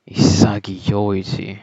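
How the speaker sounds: normal talking voice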